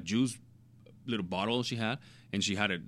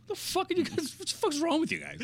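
squeaky high mock mom, cursing. Mom snapping at her kids in a mocking high voice. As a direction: play the mom as a shrill, baffled cartoon. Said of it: "And my mom is like".

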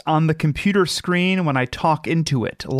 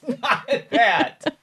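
laughing; 10 semitones higher, slower than usual. Real, not acted. Laughing at wrong guess.

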